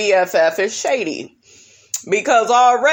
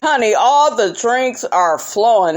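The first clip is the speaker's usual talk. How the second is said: normal talking voice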